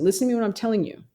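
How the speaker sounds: ordinary talk, nothing marked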